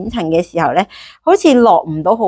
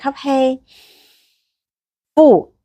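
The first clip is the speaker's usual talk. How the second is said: slow breath counting; 5 semitones higher, much slower than usual. Shown as a demonstration. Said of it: "嚟多一次咯喎".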